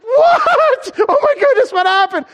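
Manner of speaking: high laughing shouted surprise; startled surprise. Retold or imitated. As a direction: react amazed and laughing. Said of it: "I'm like".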